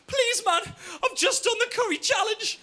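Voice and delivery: squeaky, laughing plea; high desperate begging. Retold or imitated. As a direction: beg with a strained high voice. Mate begging against wall.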